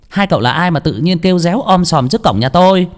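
high, scornful woman's voice; scornful village woman. Acted. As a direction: look down on the strangers. Woman character voice. (giọng đàn bà cao, khinh khỉnh)

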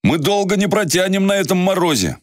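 put-on a worried young traveler; young man voice. Yarik voiced in story.

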